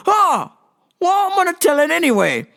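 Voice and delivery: high, breezy brush-off; undeterred joker imitation. Acting joker brushing off refusal.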